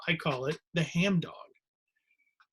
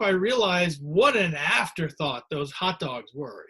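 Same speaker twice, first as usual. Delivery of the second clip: ordinary talk, nothing marked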